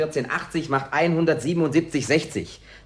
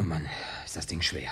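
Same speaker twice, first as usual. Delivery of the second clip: whispered, worn-out groan under the breath; breathless whisper; 8 semitones lower, 11 dB quieter than usual. Acted. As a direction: whisper it, spent from carrying. Gasping after carrying the piano.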